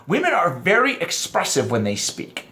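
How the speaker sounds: ordinary talk, nothing marked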